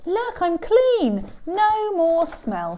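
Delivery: high, loud, slow glee; happy shout. Acted. Elephant after his shower, happy shout. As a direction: announce it with delight. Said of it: "shouted Elephant happily".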